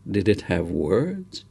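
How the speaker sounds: plain reading voice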